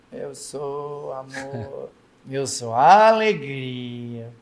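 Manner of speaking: slow, lifeless reciting of eu sou amor, eu sou alegria; a teenager droning it out to get it over with; bored recital. Retold or imitated. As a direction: drag through the words like a chore. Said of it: "Não! Você tem que incorporar".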